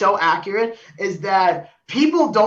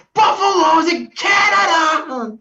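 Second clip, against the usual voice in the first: higher, louder and slow mock yell; retold shout; 9 semitones higher, 4 dB louder, slower than usual. A heckler at the game yelling at a fan, slow and loud. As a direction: bellow it like a heckler. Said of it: "somebody shouts".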